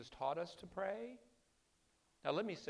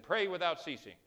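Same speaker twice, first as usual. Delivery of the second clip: louder preaching call, imitating the old preacher; retold preacher's exhortation; 5 semitones higher, 10 dB louder than usual. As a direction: boom it out like the preacher he heard. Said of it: "and he said".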